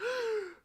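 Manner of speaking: loud, high dramatic gasp; mock gasp. Artist shocked at three thousand dollars, loud mock gasp. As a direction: suck in air in hammed-up shock.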